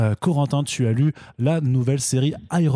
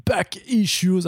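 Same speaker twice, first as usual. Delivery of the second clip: English accent; 7 semitones higher, much slower than usual. Shown as a demonstration. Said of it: "avec le bon accent anglais".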